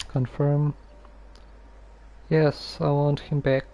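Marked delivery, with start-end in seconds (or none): none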